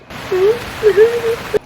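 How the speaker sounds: high, whimpering mock sobs; imitated sobbing. Crying tourist unable to answer the man, mock sobbing. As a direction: mimic helpless crying in short bursts. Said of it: "I was crying so hard".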